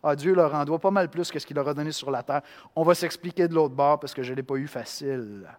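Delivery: usual speaking voice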